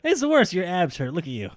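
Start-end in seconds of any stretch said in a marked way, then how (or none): 0.0-0.5 high-pitched tease. Pretend. Teasing friend.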